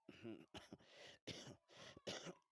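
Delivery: real repeated throaty coughs; coughing fit. Real, not acted. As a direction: cough several times, then clear the throat. Teacher unwell, coughing fit mid-lesson.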